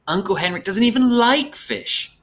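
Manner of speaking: put-on high, girlish giggly lilt; light, high-pitched put-on amusement. A narrator's reading.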